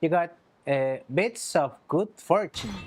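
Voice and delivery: ordinary talk, nothing marked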